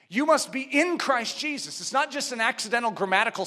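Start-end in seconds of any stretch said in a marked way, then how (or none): none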